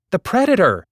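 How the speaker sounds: high, loud alarm; shout. A narrator's reading. Hazel shouting a warning over the battle, loud alarm. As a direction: yell to warn him. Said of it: "Hazel shouted at me".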